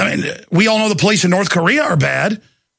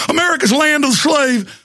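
sarcastic shout; 8 semitones higher than usual. Acted.

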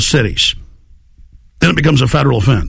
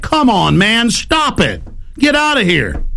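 exasperated dismissal; 7 semitones higher, slower than usual. Retold or imitated. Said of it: "And I'm like".

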